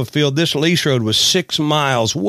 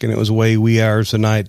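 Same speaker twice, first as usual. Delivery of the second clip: ordinary talk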